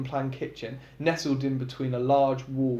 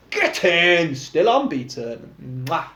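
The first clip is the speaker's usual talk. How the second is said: playful; 3 semitones higher, 6 dB louder, slower than usual. A narrator's reading. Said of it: "he says playfully".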